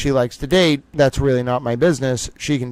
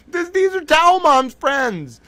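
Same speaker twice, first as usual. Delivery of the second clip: mock amazement; 12 semitones higher than usual. Real, not acted. Mock disbelief continues.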